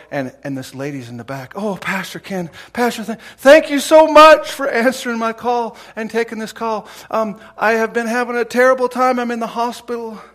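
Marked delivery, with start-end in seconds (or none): 1.5-10.2 distressed woman caller. Retold or imitated. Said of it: "he could hear a lady crying".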